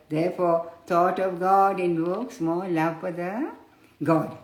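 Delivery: ordinary delivery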